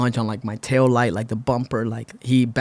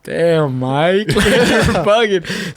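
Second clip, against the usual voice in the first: chilling; 5 semitones higher, much slower than usual. Retold or imitated. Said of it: "Johnny's just chilling like".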